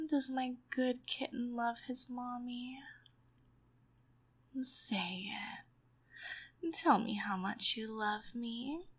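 usual speaking voice

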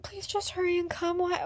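usual speaking voice

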